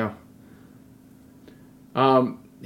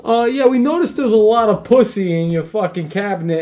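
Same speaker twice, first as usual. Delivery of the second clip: mock Bill O'Reilly; 6 semitones higher, 4 dB louder than usual. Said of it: "Bill O'Reilly, uh, Fox quote-unquote news".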